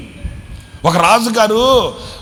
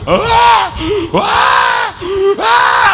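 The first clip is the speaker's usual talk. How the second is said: mock toddler crying, much higher and louder; imitated baby wail; 14 semitones higher, 4 dB louder, much slower than usual. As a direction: wail like a crying little boy. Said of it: "అని ఏడుస్తున్నాడు".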